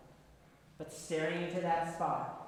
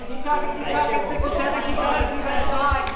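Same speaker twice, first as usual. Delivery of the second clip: loud, high coaching calls over the room; shouting instructions across a busy studio; calling out; 8 semitones higher, 15 dB louder, faster than usual. Real, not acted. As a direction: project over the noisy class. Coaching moving group over noise.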